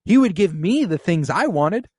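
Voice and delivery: high, loud insistent queen; queen insisting her father would favor her; mock outburst. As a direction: make the demand blunt and loud. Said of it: "And she's like".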